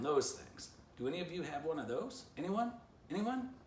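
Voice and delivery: high, eager pitch rising to a squeaky plea; Poo Poo excited. A narrator's reading. Poo Poo asks everyone for a trampoline, high and eager. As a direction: pester them with growing hope.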